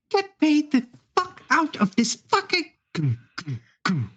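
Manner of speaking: high-pitched, put-on character voice; trapped creature's voice. Someone stuck in goo swearing, high put-on voice. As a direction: curse from the muck in a high voice.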